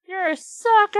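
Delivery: high, loud mocking taunt; a kid's triumphant gotcha, shouted; gleeful exclaim. A narrator's reading. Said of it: "I exclaimed and burst out laughing".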